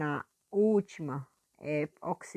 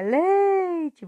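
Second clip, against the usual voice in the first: "leite" with LEI stretched, high and loud; exaggerated long stress on LEI in "leite"; stressed syllable; 12 semitones higher, 9 dB louder than usual. Shown as a demonstration. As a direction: hit and hold the first syllable of "leite". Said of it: "E na sílaba forte".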